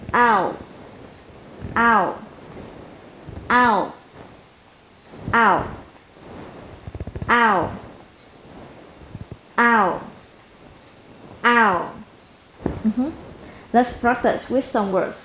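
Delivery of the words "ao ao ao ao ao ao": "Ao" from a wide A into a short rounded oo; diphthong demo. Shown as a demonstration. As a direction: model the "Ao" glide clearly, falling at the end. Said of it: "chuyển thành môi hơi tròn và kéo lưỡi về". (âm "Ao": mở rộng âm A rồi tròn môi thành ư ngắn)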